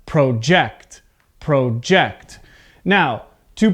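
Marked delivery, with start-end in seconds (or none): 0.0-1.0 teacher's careful verb project, pro-JECT; stress on second syllable. Shown as a demonstration. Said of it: "So this sounds like". 1.4-2.4 careful verb project, stress at the end; stress on second syllable. Shown as a demonstration. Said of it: "the stress is at the end of the word".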